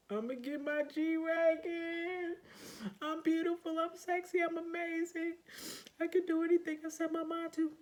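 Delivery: high squealed self-hype, loud; hyped affirmations. Retold or imitated. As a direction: shout it at yourself like a cheerleader.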